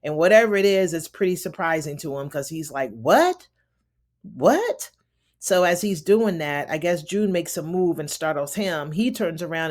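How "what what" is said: rising, louder startled question; a groggy man jolted by news; mock surprise. Commander startled by the caller, mock surprise.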